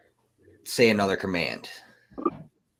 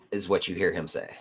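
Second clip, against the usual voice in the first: normal talking voice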